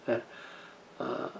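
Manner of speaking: ordinary talk, nothing marked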